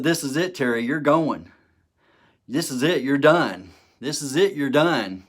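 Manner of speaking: loud, insistent self-talk, pushing himself over the edge; urging himself on. Retold or imitated. Alone on the bunk, pushing himself to jump, loud and insistent.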